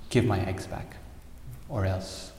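a restrained, firm demand; understated angry sparrow. Acted. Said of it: "she got very angry".

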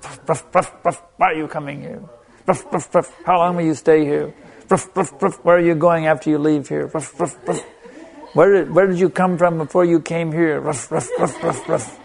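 a light mock dog bark, questions yapped in between; lightly hammed-up barking officials; playful imitation of barking border guards. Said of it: "Prabhupada compared them to dogs".

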